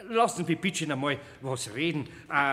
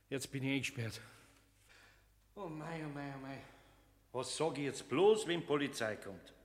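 dismayed lament; 3 semitones lower, 8 dB quieter than usual. Acted. Alone, locked in cellar.

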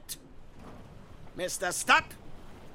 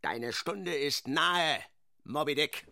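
low menace; 7 semitones lower, 5 dB quieter than usual. Acted. Ahab addressing the whale.

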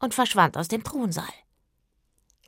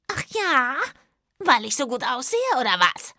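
ächzend; 5 semitones higher, 4 dB louder than usual. Acted. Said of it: "ächzte der König".

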